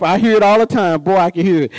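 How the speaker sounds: ordinary talk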